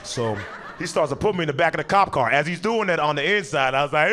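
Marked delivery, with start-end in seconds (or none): none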